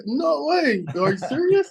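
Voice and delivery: high-pitched, incredulous imitation; amazed disbelief. Officer learning the driver is a pro player, amazed disbelief. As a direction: mimic a star-struck officer who can't believe it.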